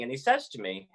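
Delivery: ordinary talk, nothing marked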